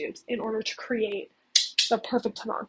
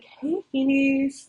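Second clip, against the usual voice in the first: slow, drawn-out breezy hello, a bit louder; casual greeting; 3 semitones higher, 5 dB louder, much slower than usual. Retold or imitated. Saint Germain greeting the dying Phineas, slow and breezy. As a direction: stretch the greeting out lazily, unbothered.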